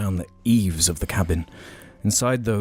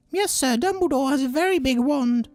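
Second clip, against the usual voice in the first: very high squeaky house elf voice; squeaky Dobby voice. Acted. Reading the house elf's line to friends, squeaky high. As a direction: pitch way up into a squeaky elf falsetto. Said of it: "doing the voices and everything".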